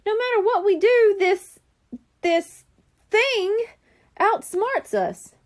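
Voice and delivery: high, loud and halting, slowing at the end; a boy spooked and stumbling over this; spooked kid. Acted. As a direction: stammer it out, rattled by the thing. Nate stammers, spooked by creature.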